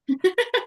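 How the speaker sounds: bright high burst of laughter. Real, not acted. Speaker laughing after retelling friend's shock, high laugh.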